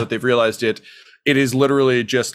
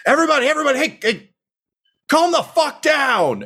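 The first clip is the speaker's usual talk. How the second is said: innkeeper yelling. Acted. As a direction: shout down a rowdy crowd. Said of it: "she just yells".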